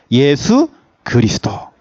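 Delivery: slow, weighty "예수 그리스도" with stress on 예수; emphatic preaching. Real, not acted. Pastor driving home the one answer to his flock, slow. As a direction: lay down "예수 그리스도" slowly as the answer.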